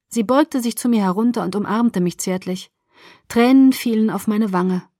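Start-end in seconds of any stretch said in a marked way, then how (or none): none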